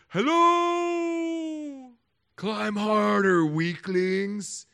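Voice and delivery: high and loud, calling down from far above; distant mocking god. Preacher mocking a distant god who yells down at weaklings, high and loud. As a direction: taunt the climbers from a mountaintop. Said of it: "God doesn't sit up on the mountains".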